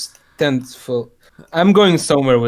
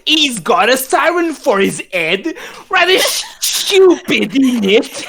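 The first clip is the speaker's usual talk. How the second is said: high squeaky cockney voice; British accent; 10 semitones higher, 4 dB louder than usual. Pretend. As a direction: pitch up into a silly cockney. British accent riff continues.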